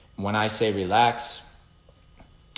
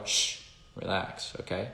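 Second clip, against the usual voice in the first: slow, softer whispered shush to a dog; calm the dog down in a slow whisper; soothing hush; 7 dB quieter than usual. Retold or imitated. Calming a dog on its side.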